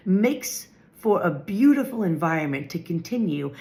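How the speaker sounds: ordinary delivery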